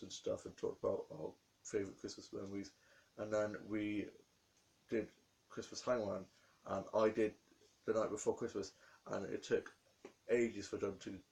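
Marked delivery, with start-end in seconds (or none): none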